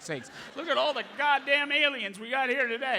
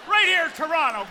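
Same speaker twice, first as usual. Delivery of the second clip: loud, high emphatic outburst; worked up; 7 semitones higher, 9 dB louder than usual. Real, not acted. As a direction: get carried away and belt it out. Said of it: "Sorry, I'm getting worked up".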